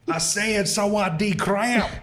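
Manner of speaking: high, slow mock yell. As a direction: yell bad Thai slowly, hamming it up. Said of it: "I just start yelling my bad Thai".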